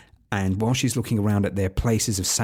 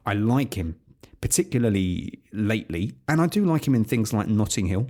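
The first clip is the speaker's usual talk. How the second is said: usual speaking voice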